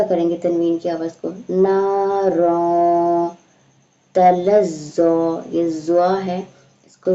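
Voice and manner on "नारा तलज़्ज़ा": slow, drawn-out chanted recitation; tajweed recitation, slow and measured; Quran recitation. Shown as a demonstration. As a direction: recite it melodically, stretching each vowel. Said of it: "teacher recites the verse".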